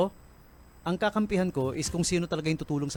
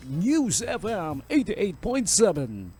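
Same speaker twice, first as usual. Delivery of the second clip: slow announcer voice for the station ID; radio station ID; 4 semitones higher, slower than usual. Shown as a demonstration. Host doing the station ID as an announcer. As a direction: boom it out like a radio stinger. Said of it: "hindi po stinger yun, Ako talaga yun".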